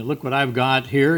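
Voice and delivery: ordinary delivery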